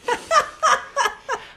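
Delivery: high run of giggles; high-pitched staccato laughter; nervous laugh. Real, not acted. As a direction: giggle helplessly before starting. Laughs before reading gross passage.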